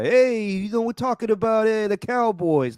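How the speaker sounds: high-pitched mock sports-host voice; Mike Greenberg. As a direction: caricature a TV host launching a topic, pitched well up. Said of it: "it's Mike Greenberg just being like".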